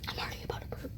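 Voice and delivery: breathy whisper, holding a burp in. Real, not acted. Fighting the urge to burp.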